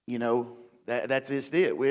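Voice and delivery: normal talking voice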